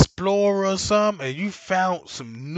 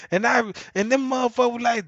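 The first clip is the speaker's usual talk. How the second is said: normal talking voice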